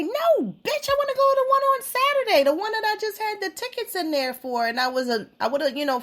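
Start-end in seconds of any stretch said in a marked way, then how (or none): 0.0-4.7 indignant retort. Retold or imitated. Retorting to ticket seller, mocking.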